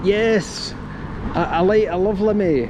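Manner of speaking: a hint of the Billy Connolly impression; mock Billy Connolly. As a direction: keep the joke Glaswegian lilt going. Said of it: "his Billy Connolly impression".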